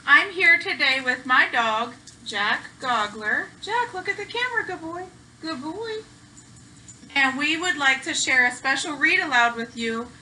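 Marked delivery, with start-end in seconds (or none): 3.6-6.0 high, fast, soft doggy praise; sweet talk to a good dog. Real, not acted. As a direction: coo at the dog like a pet. Talking to her dog.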